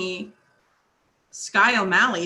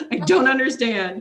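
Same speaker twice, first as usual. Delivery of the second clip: laughing disbelief; 6 dB louder than usual. Real, not acted. Author recalling the grandma's racy book, laughing. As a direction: repeat it through a laugh. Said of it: "repeats laughing".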